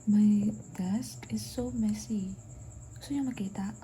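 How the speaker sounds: hushed whisper; breathy whisper so nobody wakes. Real, not acted. Whispering at night, others asleep.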